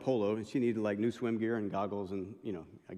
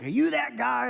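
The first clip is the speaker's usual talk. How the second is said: high voiced stranger; 11 semitones higher, 5 dB louder than usual. Stranger approaching pastor in store.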